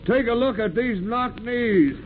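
gleeful teasing shout to the mates; jeering sailor rallying the crew to look; mocking call to the crew.

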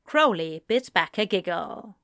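ordinary delivery, nothing marked

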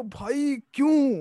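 lightly acted outcry of a baffled reader; imagined reader's protest. Writer voicing readers of his ending, mock protest. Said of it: "एकदम पढ़ के लोग बोलेंगे".

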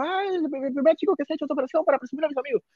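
put-on high, fast, giddy girl's voice; excited girl. A girl wanting to show off her friend, high giddy chatter. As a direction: rattle it off like a thrilled girl showing off.

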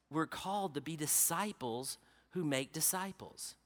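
low, soft, earnest preaching; intimate sermon close. Acted. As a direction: draw them in with a quiet appeal. Quiet earnest closing appeal.

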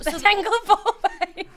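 slow, laughing it out; dissolving into laughter on the words. Real, not acted. Echoes the joke, cracking up.

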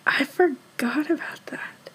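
hushed whisper of realization. Real, not acted. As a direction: whisper it as a sudden aside. Sudden aside on forgotten detail.

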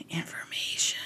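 breathy under-the-breath word; a whispered mutter; whispered aside. Real, not acted. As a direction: whisper it to yourself while looking it up. Muttering to self while searching.

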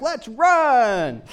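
high, loud, drawn-out rallying cry; hammed-up gung-ho holler; eager shout. Runner rallying others to head out, hammed-up eager shout.

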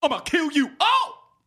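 high loud action hero line. Shown as a demonstration. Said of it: "That wasn't that bad".

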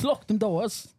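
put-on high, slow British accent; hammed-up British accent, slowed; mock British accent. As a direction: drawl out a silly British accent. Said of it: "Wait, that's British".

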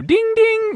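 ding ding sung high like a fight bell; mock boxing bell. Host announcing round two, mock bell ding.

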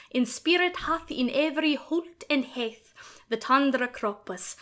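Chaucer-era Middle English pronunciation. Shown as a demonstration. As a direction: recite in reconstructed Middle English. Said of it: "in my very best Middle English dialect".

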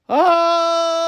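long, held, high-pitched cry of disbelief; high drawn-out "oh". Acted. Reacting to a woman breastfeeding her cat, high drawn-out "oh". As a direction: hold a high "ohhh" in mock horror.

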